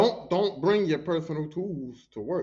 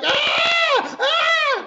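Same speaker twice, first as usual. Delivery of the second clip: long high-pitched mock scream; loud, drawn-out retold shriek; imitated screaming; 8 dB louder than usual. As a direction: scream like someone pinned and panicking. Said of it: "we heard screaming".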